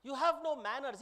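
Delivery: indignant, affronted scolding; offended, emphatic outrage; very upset. Acted. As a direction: scold her as if deeply insulted. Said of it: "He's very upset".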